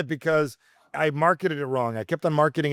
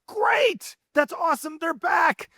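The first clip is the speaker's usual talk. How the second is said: high, bright mock-excited cheer for the nervous daughter; a parent delighted the butterflies are back; much slower than usual. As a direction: cheer her nerves on like good news. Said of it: "I'm like".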